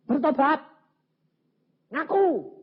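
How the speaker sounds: high, loud order to repent; stern command. Retold or imitated. Preacher ordering the weeping husband, loud stern command. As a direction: bark the command firmly.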